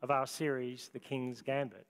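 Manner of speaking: usual speaking voice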